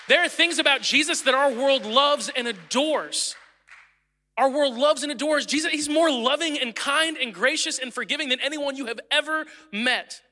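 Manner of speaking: preaching. Acted. Pastor preaching to the congregation, rising and fervent. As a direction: lift the room with praise.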